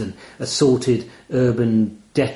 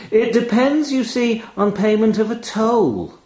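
high, sly demand; name the price like a doorman; raven's voice; 10 semitones higher than usual. A narrator's reading. Raven demanding a toll from the narrator, high and sly.

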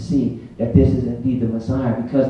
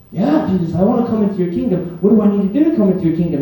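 fast, higher-pitched eager reply; eager disciple; 4 semitones higher, faster than usual. Acted. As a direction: jump at the offer like a keen follower. Eager disciple answers Jesus.

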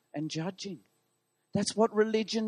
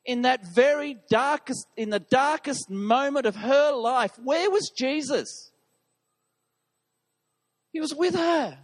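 full-force preaching; 6 semitones higher, 4 dB louder than usual. Acted.

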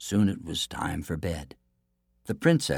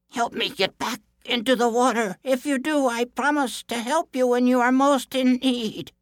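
high-pitched fish voice; salmon calling out; 4 dB louder than usual. A narrator's reading. Stranded salmon begs the prince for help, calling out high. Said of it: "it called out to him".

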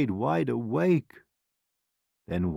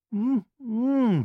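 sweetly; 12 semitones higher than usual. A narrator's reading. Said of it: "she said sweetly".

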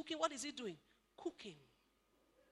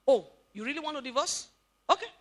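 mock casual man; 10 dB louder than usual. Man answers wife's divorce threat.

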